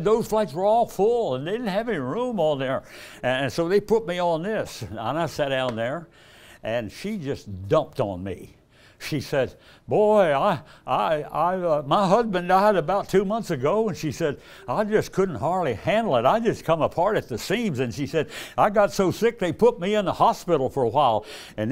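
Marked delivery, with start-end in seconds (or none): 0.0-4.8 higher, louder woman's voice in a retold chat; voicing the lady. Preacher voicing a lady passenger, higher and louder. 9.9-17.8 high, loud retelling of a widow's troubles; voicing the grieving widow. Preacher retelling a new widow's woes, high and loud. Woman telling her grief. 18.6-21.3 high, loud retelling of a widow's troubles; voicing the grieving widow. Preacher retelling a new widow's woes, high and loud. Woman telling her grief.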